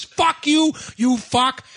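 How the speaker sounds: high, loud furious cursing; angry yell. Pretend. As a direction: hurl it at the office, hammed-up. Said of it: "then you dump".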